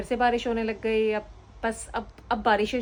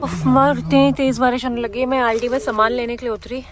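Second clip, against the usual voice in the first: loud, raised-pitch burst of dismay; real exasperated exclamation; 3 semitones higher, 8 dB louder than usual. Real, not acted. Caught out in heavy rain, exclaiming in loud dismay. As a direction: exclaim loudly over the rain.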